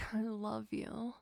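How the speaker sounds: "I love you" spoken aloud with a lift on "I"; voiced I love you. Repeated love declaration.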